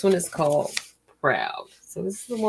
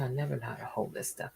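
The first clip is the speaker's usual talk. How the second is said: normal talking voice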